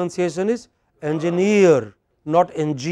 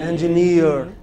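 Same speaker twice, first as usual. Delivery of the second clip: Engineer with the stress on -neer; stress on final syllable. Shown as a demonstration. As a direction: lean on the last syllable of Engineer. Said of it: "where stress is on the final syllable".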